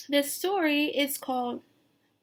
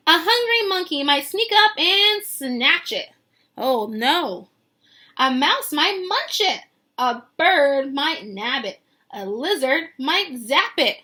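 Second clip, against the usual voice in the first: louder, punchy danger read; dramatic reading; 7 dB louder than usual. Acted. Teacher reading the predators page aloud, louder and dramatic. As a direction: build the threat for the kids.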